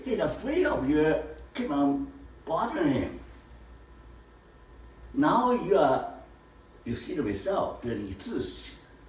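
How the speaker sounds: ordinary talk, nothing marked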